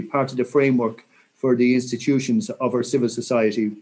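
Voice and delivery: ordinary talk, nothing marked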